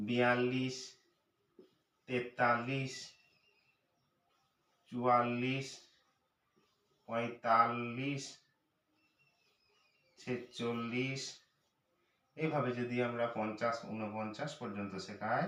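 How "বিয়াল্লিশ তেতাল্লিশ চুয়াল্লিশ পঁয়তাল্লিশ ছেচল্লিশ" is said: very slow, the ল্লিশ endings drawn out; slow, deliberate counting for children; slow counting demo. Shown as a demonstration. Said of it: "দেখুন একচল্লিশ".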